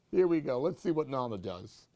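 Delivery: usual speaking voice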